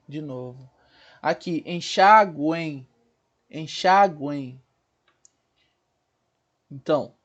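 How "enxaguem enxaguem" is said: "enxaguem" said slowly, GUEM gliding as "gu-ein"; triphthong demo. Shown as a demonstration. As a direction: draw out "enxaguem", sounding the U and a final I. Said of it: "sonoricamente falando, um I". ("enxaguem" devagar, com o tritongo bem marcado)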